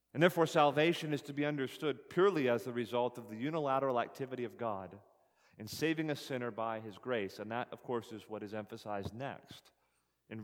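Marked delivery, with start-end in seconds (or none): none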